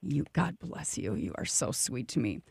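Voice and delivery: soft, hushed thanks; a quiet, grateful aside; touched and tender. Real, not acted. Host softly thanking the priest, touched.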